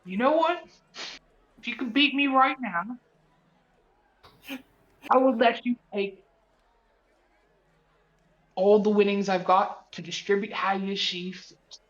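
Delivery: a hint of a cartoon villain's wager. Mentor offers mentee a wager, in a Pegasus-like voice. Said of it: "You doing Pegasus from Yu-Gi-Oh?".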